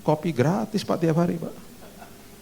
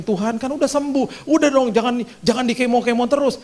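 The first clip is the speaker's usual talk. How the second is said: whiny complaint; 11 semitones higher than usual. Retold or imitated. Complaining to God.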